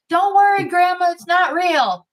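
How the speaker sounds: put-on high, bright kid voice reassuring; kid's reassurance. Grandson tells grandma the fight is fake, high kid voice. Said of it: "grandson reassures".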